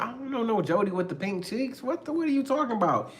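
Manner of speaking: high-pitched acted innocence; defensive denial. Partner denying knowing Jody, high and defensive. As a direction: protest it, rising high at the end.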